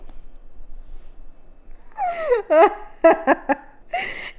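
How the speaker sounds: long burst of laughter; loud rising laugh. Real, not acted. Laughing at cringe scene.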